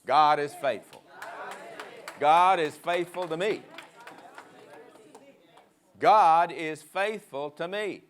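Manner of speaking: loud, forceful faith declaration; emphatic declaration. Shown as a demonstration. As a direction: say it loud like you mean it. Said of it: "say it like you mean it".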